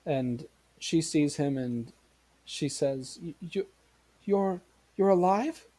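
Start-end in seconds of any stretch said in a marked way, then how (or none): 3.2-5.7 halting, rising question in disbelief; stunned mother. Acted. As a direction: stammer it higher, ending on a question. Mother sees dead son speak.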